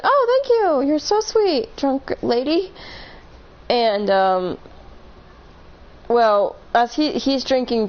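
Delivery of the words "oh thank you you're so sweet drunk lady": high, sugary mock politeness; thanking a drunk stranger in a high, mock-sweet voice. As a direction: play it syrupy-sweet to humour her. Mock-sweet reply to drunk woman.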